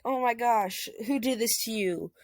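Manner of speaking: a hint of a frantic mother; worried mom. Mother Inko asks who hurt son, mild worry. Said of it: "His mom just like".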